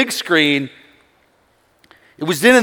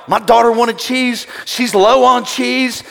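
hammed-up Papa Bear outrage; 6 semitones higher, slower than usual. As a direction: defend your daughter's cheese. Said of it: "I went Papa Bear".

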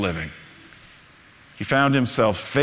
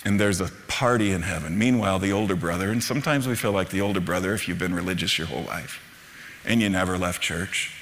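ordinary talk, nothing marked

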